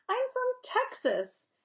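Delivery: high and slow, leaning on Texas; a proud little Texan setting her straight; Robert's twangy reveal. Acted. Robert correcting Nancy, proud and slow.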